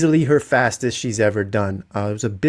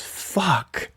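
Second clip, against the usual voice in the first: put-on higher, small child voice saying it; a little kid muttering at dropped food; mimicking toddler son; 6 semitones higher, 6 dB quieter than usual. Dad imitating his three-year-old swearing at dinner, mild child voice.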